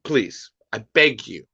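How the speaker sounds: ordinary delivery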